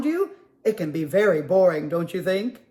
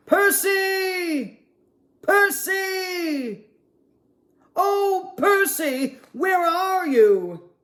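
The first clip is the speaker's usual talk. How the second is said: much higher calling across the snow; 9 semitones higher, much slower than usual. Acted. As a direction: call for the lost penguin into the wind. Penguins searching in snowstorm.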